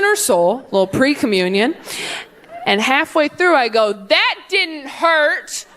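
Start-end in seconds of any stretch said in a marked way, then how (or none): 3.9-5.7 slow defiant taunt. Retold or imitated. Kid mouthing off.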